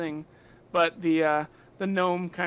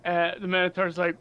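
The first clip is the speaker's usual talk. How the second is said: usual speaking voice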